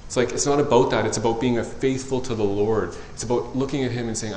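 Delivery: normal talking voice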